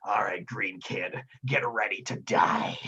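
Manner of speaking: slow, hoarse whispered threat; menacing villain. Acted. Game master as pirate threatening a young arcanist, menacing whisper. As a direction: rasp it slowly in a voiceless villain whisper. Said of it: "and he says".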